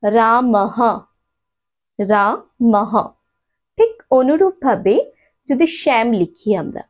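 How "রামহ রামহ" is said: রামহ with a clear breathy হ at the end; visarga as ha. Shown as a demonstration. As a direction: say রামহ, letting the visarga sound as হ. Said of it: "এটাকে আমরা কিভাবে উচ্চারণ করব?". (বিসর্গ হ দিয়ে উচ্চারণ)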